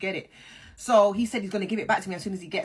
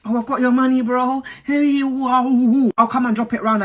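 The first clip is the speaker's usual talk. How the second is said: mocking, hyped-up friend; 3 semitones higher, 8 dB louder than usual. Mocking his excuses.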